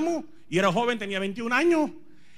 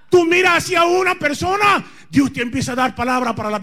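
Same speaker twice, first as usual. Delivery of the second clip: shouted, fiery sermon; preaching at full force; 10 semitones higher, 8 dB louder than usual. Acted.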